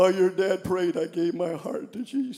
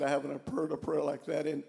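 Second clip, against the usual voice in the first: choked voice; 4 semitones lower, 10 dB quieter than usual. Retold or imitated. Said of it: "In a choked voice".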